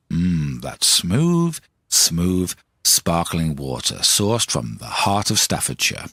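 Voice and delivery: low, slow mock advert read. Podcaster plugging his sip of sparkling water, mock advert voice. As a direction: savour it like a smooth drinks commercial.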